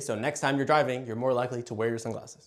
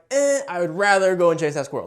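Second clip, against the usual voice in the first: lightly voiced dog, louder, brushing off an offer; a hint of a dog turning down a treat, louder; dismissive dog; 3 semitones higher, 5 dB louder than usual. Dog turning down treats to chase a squirrel, mildly dismissive. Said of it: "he's like".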